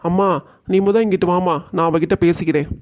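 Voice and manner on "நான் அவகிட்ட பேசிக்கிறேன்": normal talking voice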